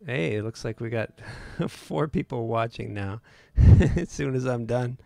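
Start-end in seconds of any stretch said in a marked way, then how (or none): 1.4-5.0 chuckling while speaking; amused laugh through words. Real, not acted. Laughs at viewer count.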